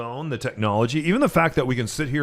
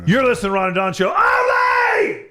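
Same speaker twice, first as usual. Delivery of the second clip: announcer voice; 12 semitones higher, 5 dB louder than usual. Acted. Station ID on cue.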